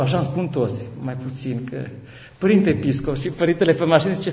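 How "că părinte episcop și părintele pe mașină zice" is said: normal talking voice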